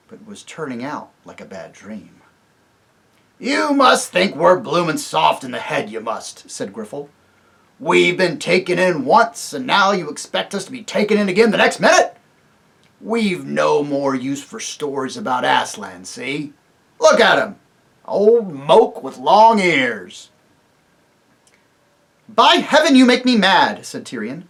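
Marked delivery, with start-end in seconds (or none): 3.4-6.5 loud sneering dwarf; mocking dwarf. Griffle mocks the king, loud and sneering. 7.8-20.3 loud, scornful dwarf; contemptuous dwarf. Acted. Griffle jeers at Puzzle, loud and contemptuous. 22.3-23.9 much higher, loud angry outburst; a king losing his temper; furious king. Acted. Tirian rages at the dwarfs, loud and furious.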